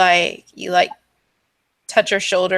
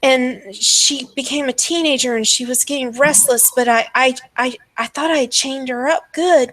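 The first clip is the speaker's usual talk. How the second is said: higher, anguished obsessive mother; distraught madwoman; 6 semitones higher than usual. Acted. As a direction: pour out a mad mother's worry, stumbling over words. Game master plays distraught mother.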